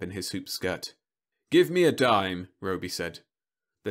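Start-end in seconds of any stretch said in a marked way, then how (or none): 1.5-2.5 robot salesman voice. Acted. Robot asks for coin.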